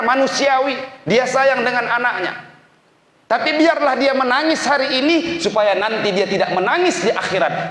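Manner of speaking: high, loud fervent sermon voice; a preacher pleading with young students; impassioned preaching. Acted. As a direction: preach it out with full emotional force. Preacher rises to full force.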